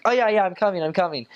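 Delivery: lightly mimicked, high and airy brush-off; breezy brush-off. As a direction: wave off the question with easy reassurance. Mimicking the late investigator again.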